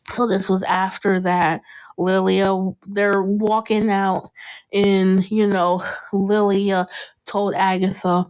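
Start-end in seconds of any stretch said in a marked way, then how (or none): none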